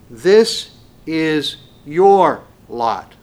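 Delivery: drawn out, weighty and loud; slow emphatic reading. Acted. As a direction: lean on every word. Preacher stressing scripture phrase.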